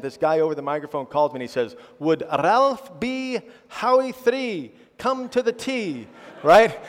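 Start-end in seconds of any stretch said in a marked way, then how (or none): 2.0-6.1 put-on higher, drawn-out Indian-accented announcer. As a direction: call the golfer up slowly in an Indian accent. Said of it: "in this wonderful Indian accent".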